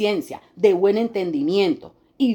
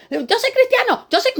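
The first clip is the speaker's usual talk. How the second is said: much higher, louder whiny mock voice; mocking boaster; 3 dB louder than usual. Preacher mocks a believer boasting of faith, whiny high parody. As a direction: parody a self-important churchgoer bragging.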